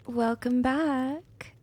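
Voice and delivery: normal talking voice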